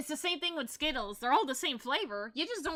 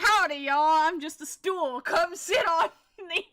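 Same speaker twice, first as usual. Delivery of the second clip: a goofy cowboy trying too hard to fit in; mock cowboy voice; 5 semitones higher, 8 dB louder than usual. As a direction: ham up a fake cowboy greeting. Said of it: "he's like".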